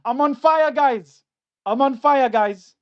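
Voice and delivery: excited shouting. Real, not acted. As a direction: crow it to the chat. Celebrating his joke live.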